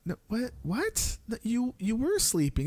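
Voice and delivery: confused dad. Retold or imitated. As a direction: sound puzzled and incredulous. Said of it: "But I'm like".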